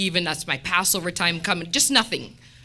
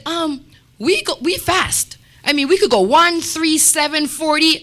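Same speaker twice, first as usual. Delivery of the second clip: higher and louder, counting out the fast days; telling coworkers how long she fasts, loud and proud; retold firm reply; 7 semitones higher, 5 dB louder than usual. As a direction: proudly list the days, raising her voice. Retelling her own firm reply.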